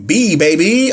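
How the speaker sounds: normal talking voice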